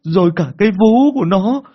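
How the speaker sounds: put-on high, loud frightened child's sobbing account; a terrified boy crying through his story; crying harder. Boy tells uncle about the ghost woman, crying loud and high. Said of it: "anh Quang lại càng khóc to hơn".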